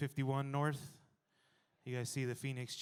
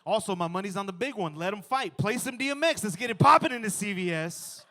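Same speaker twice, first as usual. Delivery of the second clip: high, loud hype-man cheering; hyped fight fan; 7 semitones higher, 10 dB louder than usual. Pretend. Egging on the lap dogs, loud hype-man cheer. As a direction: whip up the crowd like a ringside fan.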